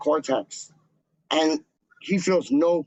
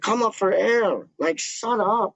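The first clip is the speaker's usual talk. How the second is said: higher-pitched mock exasperation; retold fed-up plea, pitched up; imitated exasperated dad; 7 semitones higher than usual. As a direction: imitate a dad begging for silence. Imitating exasperated dad telling him off.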